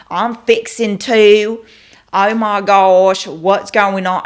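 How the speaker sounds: loud, slow Southern drawl; Southern accent. Shown as a demonstration. Showing what she wouldn't say, exaggerated Southern accent. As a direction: stretch it out in a country Texas twang. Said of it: "have a Southern accent".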